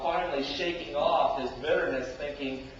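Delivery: ordinary delivery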